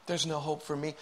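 low, soft, defeated admission; subdued, despairing murmur; hopeless self-pity. Acted. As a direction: give up quietly, as if throwing in the towel. Voicing self-pity and giving up.

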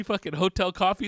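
ordinary talk

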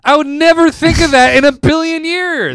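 high, loud amazed exclaim; excited disbelief. Real, not acted. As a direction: marvel at the twist. Admitting the twist surprised him.

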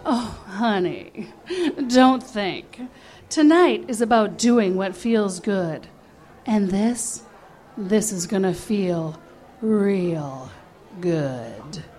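low, slow seductive purr; a hint of sultry drag queen. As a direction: lightly play the drag queen coaxing her.

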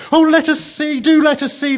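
high, loud, eager pleading; shrieky little chorus of pleading; children crying out. A narrator's reading. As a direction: beg excitedly like children clamouring. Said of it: "the children cried both at once".

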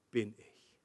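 low, soft "bin ich" after a pause; understated punchline. Acted. Preacher quotes Jesus's claim to the congregation, understated. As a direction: let "bin ich" drop quietly. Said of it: "dass das wichtige Wort ist".

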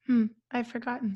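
ordinary talk, nothing marked

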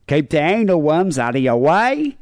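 slow, exaggerated Aussie upturn on "way"; hammed-up Australian rising inflection on "Keep the anal worms out of your way"; imitating Australian rise. Shown as a demonstration. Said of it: "It's the rising inflection".

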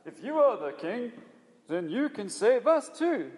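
high, loud jeering call; slow, loud taunt from the cross; scornful shout. Retold or imitated. The criminal taunting Jesus from the cross, with a high loud slow jeer. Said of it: "this guy who's just shouted out".

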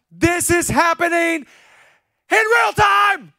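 high, drawn-out preacher's call building to a shout; Holy Spirit calling out. Acted. Holy Spirit calling a person by name, urgent building call. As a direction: call out slowly and urgently, rising to full cry. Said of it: "The Holy Spirit at the end of Proverbs one is like".

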